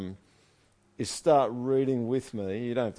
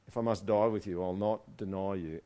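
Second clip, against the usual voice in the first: normal talking voice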